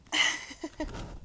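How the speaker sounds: soft, voiceless chuckle; hushed, airy giggle; quiet laugh. Real, not acted. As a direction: let out a small real laugh under the breath. Laughs during a breathing pause.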